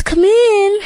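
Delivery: high, put-on granny voice; high-pitched fake grandmother call; wolf acting like Granny. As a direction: lift it high, pretending to be Granny. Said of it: "who's acting like Granny".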